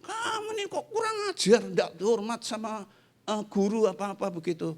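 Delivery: scolding teacher. Retold or imitated. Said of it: "ada teman saya yang marah-marah".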